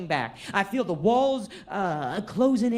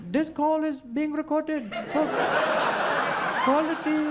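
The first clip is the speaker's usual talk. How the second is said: higher fake Irish accent; hammed-up Irish accent, pitched higher; bad Irish accent; 4 semitones higher than usual. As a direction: keep up the botched Irish voice. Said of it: "I did the whole order like that".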